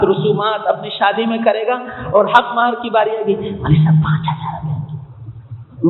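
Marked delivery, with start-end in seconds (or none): 3.3-5.9 slow, breathless whispered offer of five thousand; mock whisper. Preacher mimics a groom offering meager dower, whispered. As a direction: drag out पाँच in a sneaky whisper.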